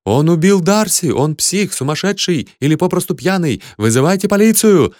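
high, excited cries of alarm; outraged onlookers crying out; panicked yelling. A narrator's reading. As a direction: raise the alarm, building to a shout. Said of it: "орали они".

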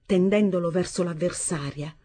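usual speaking voice

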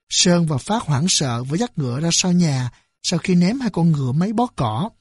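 ordinary delivery

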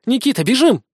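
restrained narrated cry of alarm; urgent exclamation. A narrator's reading. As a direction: exclaim it, urging him to run. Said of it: "воскликнула девушка".

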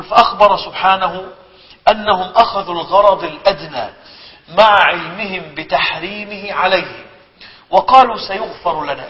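slow, measured classical reading; solemn reading of a classical scholar; formal recitation. Acted. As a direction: read the old text with weight. Reads classical text aloud.

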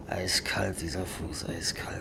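a freezing sick man whispers about his foot; pained whisper. Acted. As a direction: whisper it through the cold and pain.

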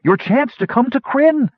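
high, urgent pleading; desperate last exhortation; crying out. A narrator's reading. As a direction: urge them on with a rising cry. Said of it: "she cried".

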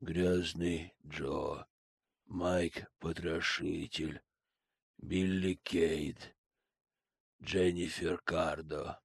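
slow, soft, flat recital; calm emotionless listing. Acted. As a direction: list names like a sleepwalker. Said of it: "спокойно перечислил Сэм".

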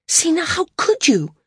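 high-pitched, indignant demand. A narrator's reading. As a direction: demand an answer, pitch leaping up. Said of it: "Maris demanded".

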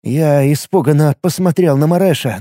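ordinary delivery

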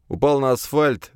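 plain reading voice

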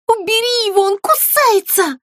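high frightened shriek. A narrator's reading. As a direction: squeal in panic in her ear. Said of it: "завизжала Злюня". (визг от испуга, высоко)

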